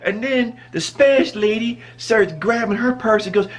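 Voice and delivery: normal talking voice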